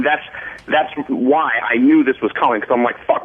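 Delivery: ordinary delivery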